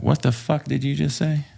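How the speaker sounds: high, outraged disbelief; can't believe what he just heard; stunned disbelief. Retold or imitated. Retelling the French officer's insult, asking back in shock.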